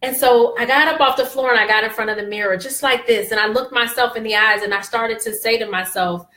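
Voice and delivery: normal talking voice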